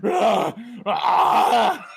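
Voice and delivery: loud animatronic roar-scream; high, blasting mock-monster shriek. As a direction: imitate a huge bear screaming, full volume. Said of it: "just screaming at him".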